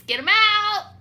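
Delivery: high, loud demand; mock yell. To the leasing office, a loud high demand. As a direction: shout him out the door.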